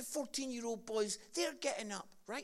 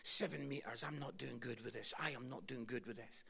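scared quiet thoughts; 10 semitones lower, 6 dB quieter than usual. Retold or imitated. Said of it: "I'm thinking".

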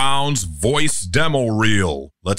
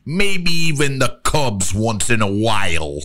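light Chicago accent; a Bears fan from Chicago; 3 semitones higher than usual. Shown as a demonstration. Chicago guy listing his teams in Chicago accent. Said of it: "Chicago guy".

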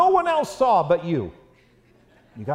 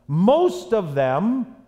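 slow emphasis; much slower than usual. Acted. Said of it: "preacher reads slowly for emphasis".

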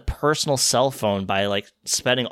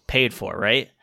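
normal talking voice

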